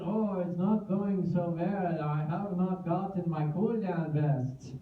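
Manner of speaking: put-on slow, clumsy Dutch accent; bad Dutch accent. Easy Wolf complains about missing vests, bad Dutch accent. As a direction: do a poor Dutch accent, slowly. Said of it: "with his lovely Dutch accent".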